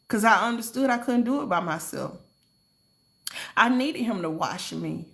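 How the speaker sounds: ordinary talk, nothing marked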